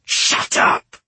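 harsh, hissed command to be silent. A narrator's reading. Savar silencing the mocking blacksmith's daughter, hissing. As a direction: hiss it sharply to cut them off. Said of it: "Savar hissed".

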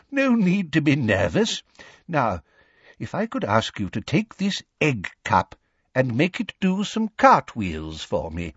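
put-on kindly, wavering old examiner; quavery old voice. A narrator's reading. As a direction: reassure in an old man's quaver. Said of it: "in his quavery old voice".

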